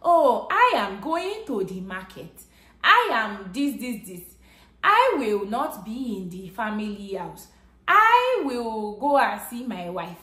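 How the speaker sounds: hammed-up Nigerian accent, mocking how films portray Nigerians. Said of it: "You're talking as if".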